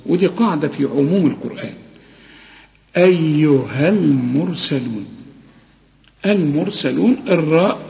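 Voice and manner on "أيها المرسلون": slow, drawn-out tajweed chanting; Quran recitation. Shown as a demonstration. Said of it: "Quran recitation demo".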